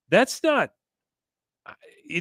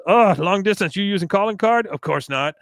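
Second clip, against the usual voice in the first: high, loud mock Kahn; imitating Kahn; 8 semitones higher, 4 dB louder than usual. Kahn on the phone, higher and louder. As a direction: snap it into the phone. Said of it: "he says".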